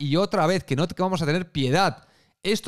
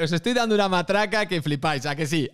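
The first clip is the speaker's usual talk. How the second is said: usual speaking voice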